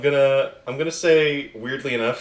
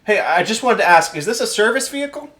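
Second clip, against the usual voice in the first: high-pitched, eager bureaucrat; high voice of an official with a clipboard; pencil-pushing clipboard official; 10 semitones higher, 6 dB louder than usual. Acted. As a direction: pipe up like a polite pencil-pusher. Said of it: "pencil-pushing motherfucker with a clipboard going".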